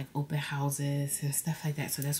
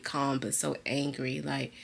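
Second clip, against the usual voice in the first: ordinary talk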